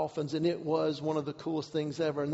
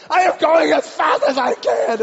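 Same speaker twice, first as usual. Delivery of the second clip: loud, high, exasperated yell; frantic shout; 9 semitones higher, 11 dB louder than usual. Retold or imitated. Paddler answering a honking ship, frantic high shout. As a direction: holler back at the honking, desperately.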